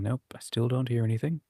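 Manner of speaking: normal talking voice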